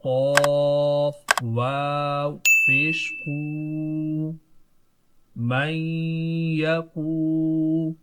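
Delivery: each letter stretched, slow and loud; drawn-out letter spelling; slow spelling drill. Shown as a demonstration. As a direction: spell every letter slowly for the class. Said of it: "अब इसको दोबारा से पढ़ेंगे".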